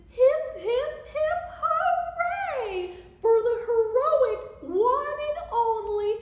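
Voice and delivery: high, loud triumphant cheer; hooted happily. A narrator's reading. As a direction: whoop it slowly like a crowd's hooray. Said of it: "They hooted".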